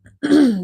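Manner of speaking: loud, hard throat clear. Real, not acted. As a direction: clear your throat forcefully. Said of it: "let me clear my throat".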